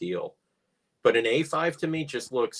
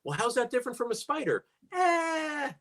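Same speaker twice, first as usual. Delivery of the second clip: higher, bouncy voices of marketers; mock marketing meeting; 11 semitones higher than usual. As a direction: play the clueless marketing team. Acted marketing meeting dialogue.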